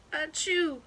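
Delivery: big sneeze. Acted. Groundhog sneezes.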